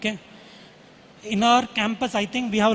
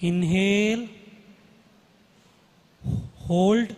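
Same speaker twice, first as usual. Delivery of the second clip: guided meditation; slower than usual. Acted. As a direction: guide the breathing calmly, slowly. Leads a breathing meditation.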